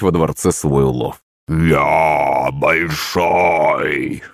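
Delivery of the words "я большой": very slow, hollow monster drawl; booming drawl. A narrator's reading. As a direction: stretch it out like a giant echo. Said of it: "гулко протянуло существо".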